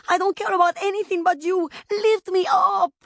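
put-on high, loud pleading child; panicked wail. A narrator's reading. Child begging mother to lift her, wailing in panic. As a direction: wail and demand in desperation. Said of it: "the child wailed in her panic".

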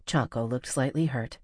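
usual speaking voice

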